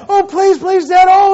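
put-on high-pitched, pleading kid's plea; begging. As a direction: beg Dad like a desperate child, hammed up. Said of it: "He will beg".

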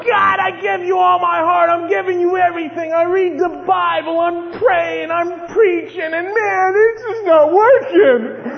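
tearful prayer, voice cracking at the end; tearful pleading. Retold or imitated. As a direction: pour out a weeping complaint to God. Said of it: "I'm going".

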